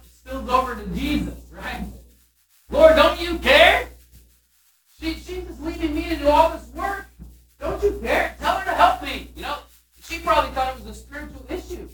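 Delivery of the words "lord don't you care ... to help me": whiny Martha. Retold or imitated. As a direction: act out Martha's exasperated nagging at Jesus. Martha complains to Jesus.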